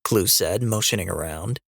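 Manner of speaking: ordinary delivery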